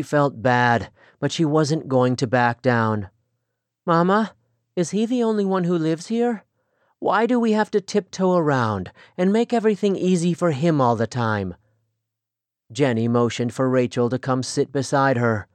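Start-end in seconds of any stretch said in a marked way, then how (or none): 3.8-11.5 higher, resentful questions; lightly voiced daughter complaining. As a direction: push back at her mother. Rachel complains to mother.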